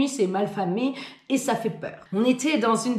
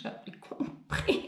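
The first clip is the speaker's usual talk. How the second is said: whispering; 10 dB quieter, slower than usual. Retold or imitated. Aside to customers, unvoiced.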